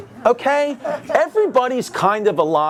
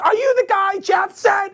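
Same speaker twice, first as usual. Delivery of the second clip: high-pitched Italian-American wife; Goodfellas mob wife; 13 semitones higher than usual. Retold or imitated. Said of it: "she looked like from Goodfellas".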